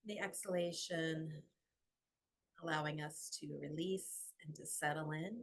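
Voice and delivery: soft, unhurried meditation voice with long pauses; guided meditation. Acted. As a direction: guide them gently into the breath.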